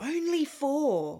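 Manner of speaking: slow, high, disbelieving question; incredulous echo. Real, not acted. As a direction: stretch it out in real disbelief. Disbelief at a low play count.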